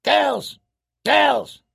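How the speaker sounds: high, harsh bird squawk; short piercing caw of a word; raven squawk. A narrator's reading. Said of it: "squawked Mormont's raven".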